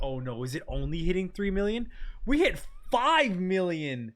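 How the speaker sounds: ordinary talk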